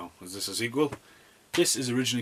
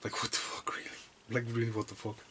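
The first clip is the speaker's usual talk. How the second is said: whispered disbelief; 6 dB quieter than usual. Retold or imitated. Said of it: "He's just like".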